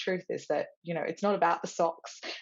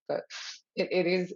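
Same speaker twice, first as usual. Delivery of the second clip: ordinary talk, nothing marked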